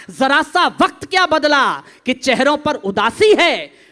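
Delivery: loud declaimed verse; rousing stage poet; forceful poetry recitation. Acted. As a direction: belt the couplet out to the back rows.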